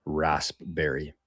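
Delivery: "raspberry" slowly sounded as rasp-berry; mock-careful "raspberry" as rasp-berry. Shown as a demonstration. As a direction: sound out "raspberry" with a p, slow and soft. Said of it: "I don't think I've ever heard somebody say".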